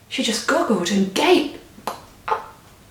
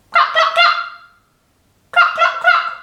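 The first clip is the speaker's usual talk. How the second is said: very high, loud clucks; hen clucking; 4 dB louder than usual. A narrator's reading. Giant hen clucking in the middle of the yard. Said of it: "the big brown hen sat down ... and went".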